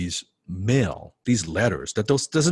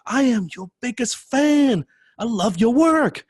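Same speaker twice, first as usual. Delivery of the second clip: high, loud, slow gush of adoration; gushing fanboy; 5 dB louder, slower than usual. Retold or imitated. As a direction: lay it on like a starstruck fan. Starstruck fan meets his idol.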